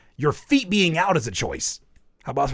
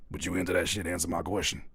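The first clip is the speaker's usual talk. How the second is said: very low, softer questioning; deeper voice; 9 semitones lower, 8 dB quieter than usual. Acted. Pressing friend for an answer, deeper voice. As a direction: press the question in a deep, hushed voice. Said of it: "that's why I'm using that voice".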